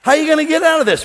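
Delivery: high, loud, fast mocking question; taunting challenge. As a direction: throw it at them like a taunt. Mocking challenge to trapped listener.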